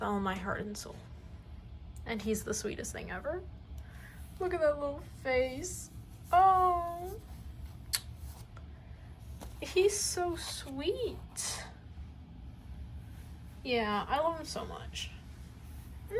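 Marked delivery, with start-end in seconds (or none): 4.4-11.6 cooing. Real, not acted. Cooing over baby Ethan drawing.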